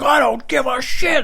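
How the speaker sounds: high, slow, loud outburst; furious shout. Retold or imitated. Driver interrupts the victim's excuse, furious drawn-out shout. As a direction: stretch every word in a high yell. Driver yells back in quarrel.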